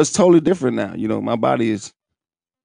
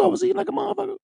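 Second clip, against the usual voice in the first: excited boast; 5 dB quieter, much faster than usual. Real, not acted. High excited boast after whoop.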